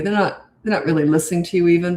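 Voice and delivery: ordinary delivery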